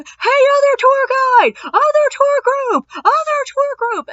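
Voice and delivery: very high, loud, drawn-out chant; rowdy calling out. Retold or imitated. Pub crawl calling to another tour group, high mock holler. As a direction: imitate drunks hollering across a street. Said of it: "they g-, start going".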